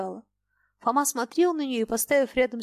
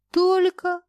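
high, louder let-down question; raised-pitch disappointed query; 9 semitones higher, 6 dB louder than usual. A narrator's reading. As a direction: ask as if expecting far more. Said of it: "В вопросе девушки звучало разочарование".

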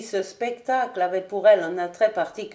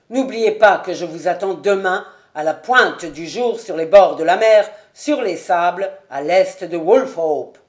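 loud challenge; 8 dB louder than usual. Acted. Colonel setting the duel on the sands, loud and fierce. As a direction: bellow the rendezvous at dawn.

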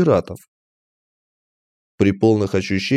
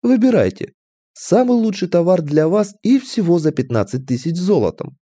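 higher, showy salesman's offer; oily fat merchant, selling; 6 semitones higher than usual. A narrator's reading. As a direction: present the goods with a flourish. (льстивый торговец, зазывно)

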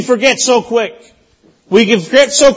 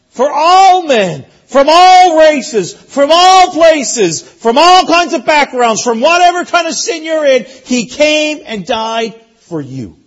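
higher, louder, slow emphatic preaching; preaching at full force; 6 semitones higher, 4 dB louder, slower than usual. Real, not acted. Pastor telling the church Christ died for all, slow and loud. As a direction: drive home each all, slowly.

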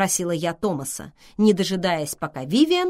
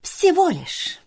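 high, louder dismissive remark; tossed-off retort; 12 semitones higher, 5 dB louder, slower than usual. A narrator's reading. Vivian belittles his boast about the muse, offhand. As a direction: throw it back dismissively. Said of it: "бросила Вивиан".

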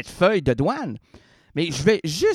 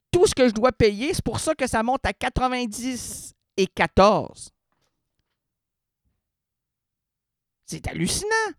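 high-pitched, incredulous outrage; rising indignation; 6 semitones higher than usual. Real, not acted. Customer fuming over customs fees on a parcel, indignant. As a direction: let the exasperation push the voice up high. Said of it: "que ma rogne prend son essor".

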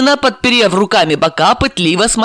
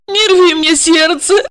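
crying; 12 semitones higher, slower than usual. Acted. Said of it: "заплакала Ольга".

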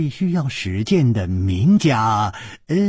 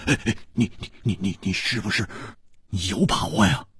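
hoarse voice; 4 semitones lower, 6 dB quieter, faster than usual. A narrator's reading. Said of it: "哑着声音说".

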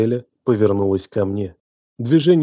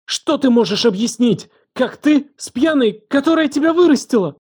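high, furious cry climbing to breaking point; 13 semitones higher than usual. A narrator's reading. Елена rages at the one she raised, voice breaking. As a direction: build the outrage until the voice cracks. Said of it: "Голос сорвался".

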